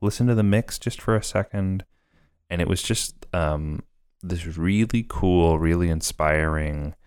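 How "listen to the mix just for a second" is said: kind director's invite. Retold or imitated. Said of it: "she just kind of was like".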